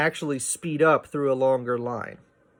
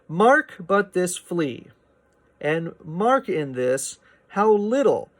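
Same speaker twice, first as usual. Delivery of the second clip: slow, higher recitation of the verse; poem reading; 4 semitones higher, 3 dB louder, slower than usual. Shown as a demonstration. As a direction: read the line out slowly like a poem. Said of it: "I tried to read it like that".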